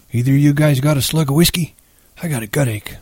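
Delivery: put-on old-timer's complaining request; old swamper. A narrator's reading. Old Candy asks for whiskey.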